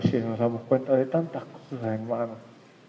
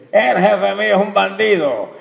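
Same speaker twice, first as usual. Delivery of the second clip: mock angry complaint; 8 semitones higher, 8 dB louder than usual. Parent badmouthing boss before kids.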